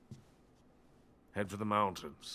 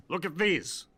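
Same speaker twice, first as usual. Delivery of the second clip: proud excitement; 9 semitones higher, 4 dB louder than usual. Acted. Proudly shows his walker designs.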